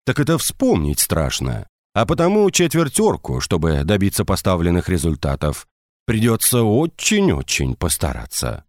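ordinary delivery

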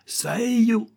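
very high, drawn-out squawk; piercingly high bird cry; parrot repeating furiously. A narrator's reading. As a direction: screech it back like an angry parrot. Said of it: "répéta rageusement le perroquet".

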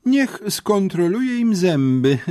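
high, slow, smiling menace. A narrator's reading. Headmistress sending boys to the dentist, smiling. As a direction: draw it out sweetly through a smile. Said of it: "ciągnęła z uśmiechem dyrektorka".

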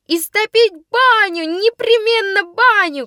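high, loud slow shouted demand; shouting. Acted. Girls in one corner demanding revenge, high slow shout. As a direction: yell for the bathhouse prank, high and slow. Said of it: "кричали в другом".

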